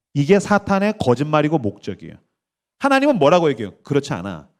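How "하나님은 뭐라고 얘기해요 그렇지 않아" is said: ordinary talk, nothing marked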